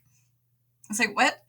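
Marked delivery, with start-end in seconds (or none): 1.1-1.4 high, incredulous rising voice; retold disbelief. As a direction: sound stunned by the absurd advice. Retold disbelief at doctor's advice.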